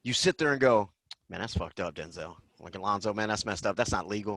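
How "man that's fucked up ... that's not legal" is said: much lower, much softer grumble; muttered disbelief. Retold or imitated. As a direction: mumble it to yourself in the seat. Audience muttering at the screen.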